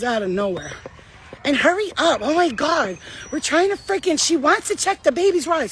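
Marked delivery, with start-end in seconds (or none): 1.4-5.7 high, hurried imitation of a pushy woman; pushy woman at a kids' ride, mocked high and hurried; mock impatient Karen. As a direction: imitate her rushing everyone. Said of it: "Starts talking to me like this".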